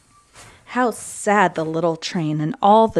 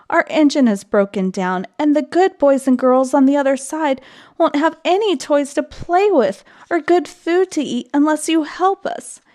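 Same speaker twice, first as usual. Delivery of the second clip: higher pitched, earnest begging; pleading cry; 7 semitones higher than usual. A narrator's reading. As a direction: plead with the little engine. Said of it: "cried the dolls and toys".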